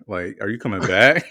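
loud, rising mock-bewildered question; comically loud retold query; incredulous question. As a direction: call after the server in exaggerated disbelief. Retold confused question to server.

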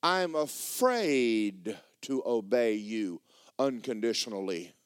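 slow, deliberate admission of fear; retold confession, drawn out; gut-honest confession. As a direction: confess it slowly, word by word. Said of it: "I just got gut honest with God".

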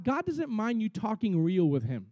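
ordinary delivery

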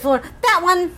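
high, quick pointed pick; a high, pleased snap choice; mock quote. Retelling how she picked the house, high and mock-delighted. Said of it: "She's like".

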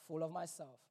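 ordinary talk, nothing marked

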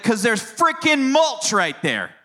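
imitated three-year-old hitting "frickin'" hard; high, slow mock-indignant child, "frickin'" punched confidently; hard, confident stress. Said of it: "hit that word real hard and confident".

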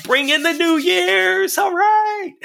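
hoarse voice cracking up high; strained, hoarse and stretched out. Real, not acted. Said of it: "My voice is just a little bit hoarse today".

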